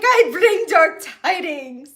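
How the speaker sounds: hammed-up, drawn-out doom announcement; Loki's proclamation. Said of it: "He's like".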